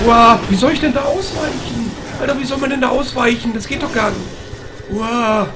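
panicked. Real, not acted. Player can't dodge the monster, high panicked wail.

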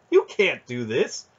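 insistent, indignant objection; indignant protest. A narrator's reading. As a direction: protest against the arrest. Arrested duke protests to soldiers.

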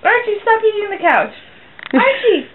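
high-pitched call to a dog. Real, not acted. Owner calling Archie off the couch, high dog-calling voice.

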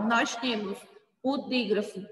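ordinary delivery

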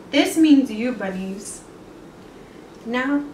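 ordinary delivery, nothing marked

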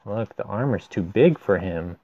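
ordinary delivery, nothing marked

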